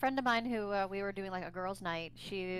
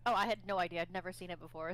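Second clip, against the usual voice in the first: normal talking voice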